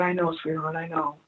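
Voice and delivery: soft, hushed reassurance; tender comfort. Retold or imitated. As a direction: comfort a crying child gently. Comforting the child, choked up.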